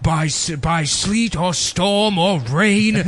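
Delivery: drawn-out, theatrical recitation; mock-solemn oath, slow and swelling; grand mock proclamation. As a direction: recite it like a pompous official creed, stretching the words. Reciting a mock mail carrier creed.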